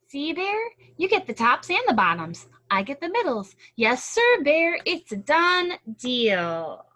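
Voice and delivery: high, loud, pleased with himself; gleeful trickster. Acted. Hare gloating to Bear over the corn, loud and high. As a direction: crow over the deal.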